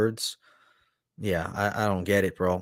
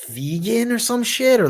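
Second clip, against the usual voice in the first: mock high voice; 12 semitones higher, 5 dB louder than usual. Mocking the singer's vegan complaint.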